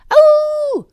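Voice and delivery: very high startled cry; startled yelp. Real, not acted. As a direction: jump at it. Startled by the dog.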